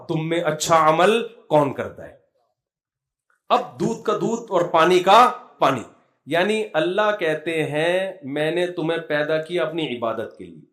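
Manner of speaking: normal talking voice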